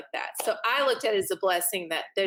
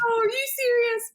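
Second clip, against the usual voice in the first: much higher, louder whiny disbelief; mock jealous surprise; 13 semitones higher, 4 dB louder than usual. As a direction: act the sister's envy. Sisters reacting jealously to news.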